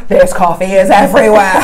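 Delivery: high, loud, laughing amazement; amazed exclaim. Retold or imitated. To her friend in Melbourne, marveling at the coffee, high and laughing. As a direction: gush like you've found treasure. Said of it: "and I was like".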